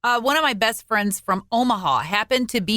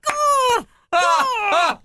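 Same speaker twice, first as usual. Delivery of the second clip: high, loud startled cries of "Gah"; startled yelps; 12 semitones higher, 3 dB louder than usual. Retold or imitated. Roommate blinded by flashlight, she cries out startled. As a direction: yelp in sudden alarm. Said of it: "And I'm like".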